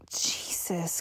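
irritated breathy whisper. Acted. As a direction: hiss it under your breath. Annoyed under-breath aside.

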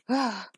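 loud, high cry of pleasure; loud moan. Acted. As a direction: let a sharp moan escape.

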